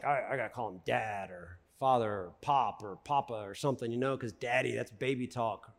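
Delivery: low, quiet sheepish guy voice; embarrassed guy. Retold or imitated. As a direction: grumble it like a grown man too cool for daddy. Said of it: "It's like".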